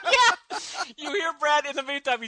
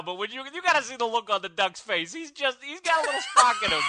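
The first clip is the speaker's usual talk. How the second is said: put-on New York accent; 2 semitones higher than usual. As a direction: talk like a pushy New York cabbie. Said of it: "my accent there".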